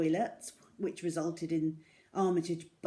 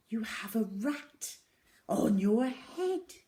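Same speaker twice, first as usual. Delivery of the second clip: slow stern teacher; 3 semitones higher, slower than usual. A narrator's reading. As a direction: spell out each word like a shocked teacher. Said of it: "repeated Miss Midge".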